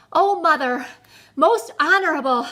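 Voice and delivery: panting. A narrator's reading. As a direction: gasp it out after a hard run. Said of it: "he panted".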